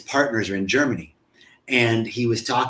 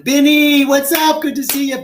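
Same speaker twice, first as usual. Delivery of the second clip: excited greeting; 12 semitones higher, 6 dB louder than usual. Real, not acted. Greeting viewer.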